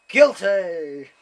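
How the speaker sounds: very loud, pronounced with mock authority; shouted verdict. Judge condemning the Italian visitor, mock-outraged shout. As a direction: imitate a judge bellowing a verdict.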